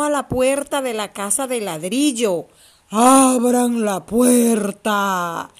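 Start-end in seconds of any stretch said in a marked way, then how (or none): 2.9-5.5 low, very slow wolf command; lightly voiced wolf, lower and dragged; big bad wolf. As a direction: draw out the wolf's order at the brick house. Wolf at brick house.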